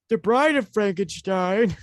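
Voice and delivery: put-on slow old man voice; a reedy elderly croak. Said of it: "That's my old man voice".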